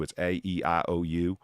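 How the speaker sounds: A E I O U stretched in a heavy Southern drawl; Southern vowels drawled long. Shown as a demonstration. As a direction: drag each vowel out like a deep Southern drawl. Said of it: "that's real drawl".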